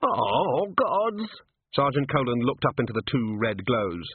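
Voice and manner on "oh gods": high, wavering wail; panicked. A narrator's reading. Sergeant hanging on as the gnome falls, panicked wail. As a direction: moan it out in dread. Watches gnome fall past.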